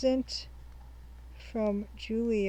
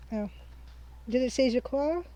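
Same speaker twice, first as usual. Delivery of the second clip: usual speaking voice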